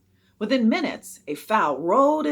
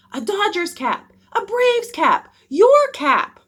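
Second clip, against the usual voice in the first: high, loud, demanding shouts; begging yells; 13 semitones higher, 11 dB louder, slower than usual. A narrator's reading. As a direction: kids clamoring from the stands. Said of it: "The begging soon turned".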